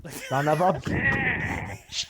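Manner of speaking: muffled yell into a pillow. Real, not acted. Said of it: "you're still talking into a pillow".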